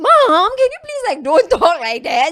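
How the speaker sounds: loud, high, half-laughing protest; retold daughterly wail with a laugh; exasperated plea. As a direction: beg mom to stop, mock-mortified. Daughter begs mom to stop.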